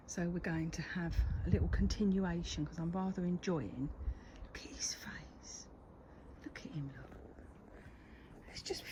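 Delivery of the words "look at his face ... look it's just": hushed unvoiced whisper, not to rouse the cat. Real, not acted. Whispering to the gardener about the glaring cat. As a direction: breathe it out so he won't hear.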